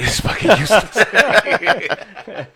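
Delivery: high, slow, laughed-through curse; laughing. Real, not acted. Laughing punchline after deadpan line.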